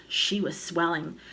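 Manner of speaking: plain reading voice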